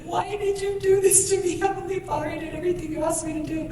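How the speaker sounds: high, soft anguished plea; heartbroken. Retold or imitated. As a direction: plead upward, small and hurt. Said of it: "'cause my heart was so broken".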